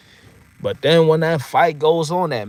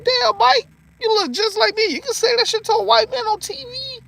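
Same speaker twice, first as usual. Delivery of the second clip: much higher, giddy squeal; squealing amazement. Retold or imitated. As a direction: squeal at Mike like a starstruck kid. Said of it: "I'm like".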